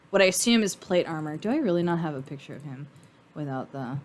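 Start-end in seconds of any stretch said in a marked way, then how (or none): none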